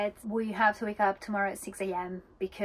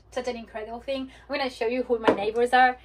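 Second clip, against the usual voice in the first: ordinary talk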